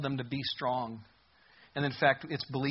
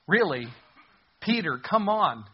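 higher, louder, slow disbelieving chiding; exasperated plea; 4 semitones higher, 6 dB louder than usual. Acted. Preacher chiding Peter for fearing a girl, exasperated. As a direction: scold Peter like a let-down friend.

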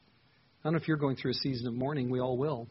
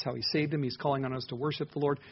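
usual speaking voice

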